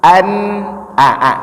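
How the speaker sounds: "An" and "a" with plain hamza, no ain; ordinary a. Shown as a demonstration. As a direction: start each "a" cleanly and openly. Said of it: "A biasa".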